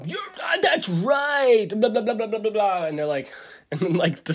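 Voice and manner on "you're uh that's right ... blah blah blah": imitating radio host. Host voiced in retold story.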